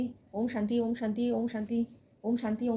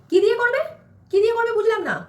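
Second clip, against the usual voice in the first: louder, higher puzzled mother-in-law; baffled; 7 semitones higher, 6 dB louder than usual. Acted. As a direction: demand what that word means.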